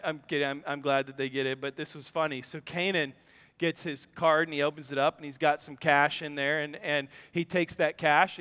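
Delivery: ordinary talk, nothing marked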